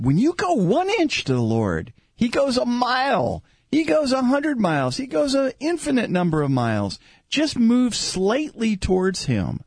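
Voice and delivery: impassioned prayer rising high, then easing and surging back; fervent preaching. Real, not acted. Preaches and prays aloud.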